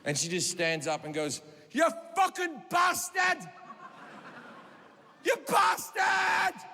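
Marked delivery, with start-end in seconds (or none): 1.7-6.6 shrieked accusation from a hysterical heckler; hysterical screaming. Retold or imitated. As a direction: scream abuse in tearful outrage. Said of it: "she's in hysterics. She's, she's, she's crying".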